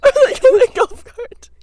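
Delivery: high, loud, helpless giggly delivery; laughing hard. Real, not acted. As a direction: barely get it out through laughter. Cracking up over silly what-ifs.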